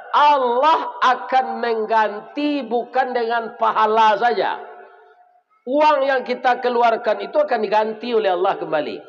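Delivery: preacher urging the congregation on, high and emphatic; preaching exhortation. Acted. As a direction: drive the promise home to the crowd. Closing exhortation from the pulpit.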